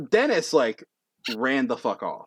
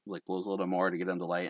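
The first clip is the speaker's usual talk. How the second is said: ordinary talk, nothing marked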